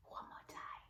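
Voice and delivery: whispered cue; soft whisper to cue the class; hushed whisper. Acted. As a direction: whisper it between rounds. Teacher cueing children between breaths.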